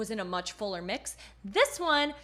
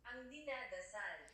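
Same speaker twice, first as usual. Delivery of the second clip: nasal resonance; 2 semitones lower, 17 dB quieter than usual. Shown as a demonstration. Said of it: "really using her nasal resonators".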